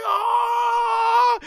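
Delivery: high, loud scream; pained scream. Retold or imitated. Goma burnt by the beam, loud scream. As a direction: scream as the flames hit. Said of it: "Goma's like".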